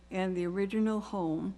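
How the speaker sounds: normal talking voice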